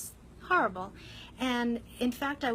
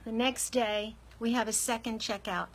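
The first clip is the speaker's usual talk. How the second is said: usual speaking voice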